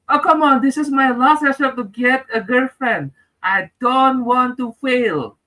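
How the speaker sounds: high, hammed-up whine of oh come on; mock despair, high and whining; whiny plea. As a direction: beg like a desperate man.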